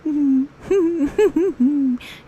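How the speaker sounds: high hearty bear laugh; a happy teddy laughing; bear chuckle. Acted. Pooh Bear laughing at the honey joke, high and hearty.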